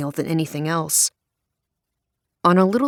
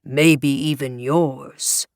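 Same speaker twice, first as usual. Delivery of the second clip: lower, slow, weighty pulpit warning; preacher's ominous punchline; 4 semitones lower, much slower than usual. A narrator's reading. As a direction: drop it slowly like a threat from the pulpit. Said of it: "best impersonation of a Southern Baptist preacher".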